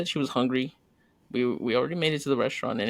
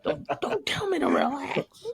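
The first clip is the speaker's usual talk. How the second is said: much higher, slow voice with a laugh; imitating angry wife; 12 semitones higher, slower than usual. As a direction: mimic the wife snapping back. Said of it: "And then she goes".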